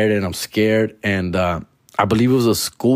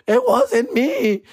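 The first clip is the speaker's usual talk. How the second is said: much higher, slow whiny "It wasn't me"; mock pleading denial; 12 semitones higher, slower than usual. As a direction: deny it like a caught kid. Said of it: "I'm doing the old".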